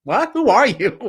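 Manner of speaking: high, loud startled question; high, loud puzzled who are you; startled what. Retold or imitated. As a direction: react like a stranger just said it to you. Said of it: "And she's like".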